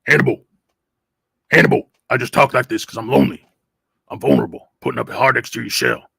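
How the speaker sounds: comic TV tough guy confessing; tough-guy impression. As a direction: put on a tough voice for a lonely confession. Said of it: "nobody goes with A-team humor".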